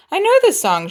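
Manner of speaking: high, bright, eager exclaim; light up at a familiar tune; woman delighted to hear her song; delighted recognition. Acted.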